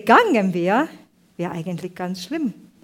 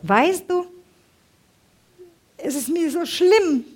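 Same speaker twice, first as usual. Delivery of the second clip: much higher, pleading imitation of a crying sister; tearful reproach; 6 semitones higher, 4 dB louder than usual. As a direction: voice a hurt sister's lament, without real sobs. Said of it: "fängt sie an zu weinen und sagt".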